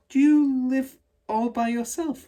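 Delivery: put-on a girl asking gently but eagerly; Matilda asking. A narrator's reading. Matilda asking Miss Honey, high slow girl voice. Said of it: "she asked".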